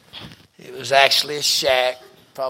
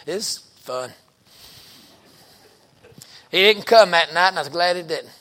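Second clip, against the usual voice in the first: ordinary talk, nothing marked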